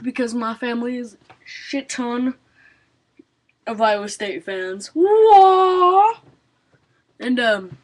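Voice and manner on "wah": excited cheer. Real, not acted. Happy cheer.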